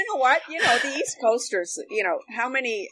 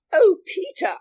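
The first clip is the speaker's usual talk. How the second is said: imitating Margaret Visser; much slower than usual. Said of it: "she said".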